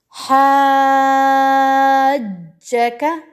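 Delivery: slowly drawn हाजका with a pressed join; six-count madd. Shown as a demonstration. Teacher reciting a Quranic example for students, long madd. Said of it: "six counts तूल दिया और जीम के साथ".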